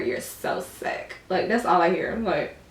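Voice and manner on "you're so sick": slow, whispery mock quote; mocking imitation. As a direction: drag out his catchphrase, barely voiced.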